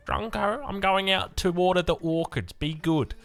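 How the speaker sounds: lightly put-on voice of a mother heading outside; imitating mum. Mum tells the kids she's going out, imitated mum voice. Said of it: "she'd always say".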